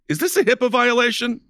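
very high-pitched mock outrage; hammed-up high voice of disbelief; mock alarm. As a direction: squeak out a put-on scandalized question. Mock outrage at doctor-patient footage joke.

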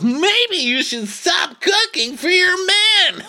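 very high, loud, slow mock shout; host shouting a jab at his guest, mocking; mock Andy shout. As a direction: bellow it like a gleeful taunt. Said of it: "So Andy goes".

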